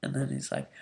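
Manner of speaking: normal talking voice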